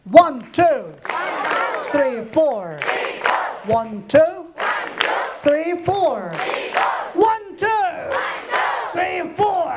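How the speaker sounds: high, called-out marching count; drill count. Shown as a demonstration. Teacher leading clapping pupils, called-out drill count.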